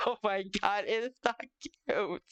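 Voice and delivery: usual speaking voice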